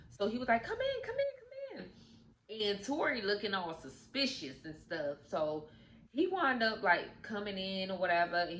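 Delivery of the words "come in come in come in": quick repeated invite, very high voice; high-pitched ushering in. Retold or imitated. Mac inviting Tori into the house, high-pitched ushering.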